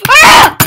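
sharp high scream; startled scream. Real, not acted. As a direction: shriek when startled. Sudden scare.

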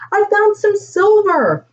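put-on high, thrilled child announcing a find; excited calling out. A narrator's reading. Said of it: "called out Grayson".

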